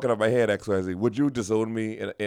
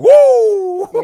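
sudden high, loud whoop; mock crackhead whoop; 14 dB louder than usual. Wide-eyed crackhead friend whooping at her, a loud high yelp. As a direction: let out a wild high yelp. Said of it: "But you wanna be like".

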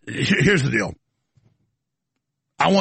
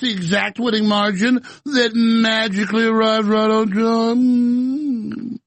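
sarcastic; 7 semitones higher, slower than usual. Acted. Mocking magical margin.

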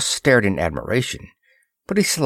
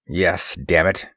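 plain reading voice

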